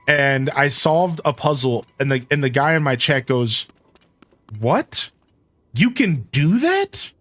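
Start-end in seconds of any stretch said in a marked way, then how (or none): 4.6-7.1 high, drawn-out astonished question; incredulous chatter. Retold or imitated. Chat guy stunned by a puzzle solution, incredulous. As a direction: voice a gobsmacked viewer, swooping up in pitch. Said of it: "this guy was just in my chat laughing".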